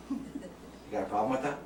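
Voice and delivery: a hint of a Bronx driver picking a fight; Bronx accent. Shown as a demonstration. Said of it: "let my accents get in the way".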